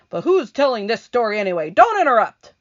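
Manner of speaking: loud, high, fast scolding; annoyed centipede. Acted. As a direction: snap at them to hush.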